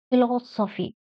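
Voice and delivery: "Philosophy" stressed on "los". Shown as a demonstration. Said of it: "Stress on L O S".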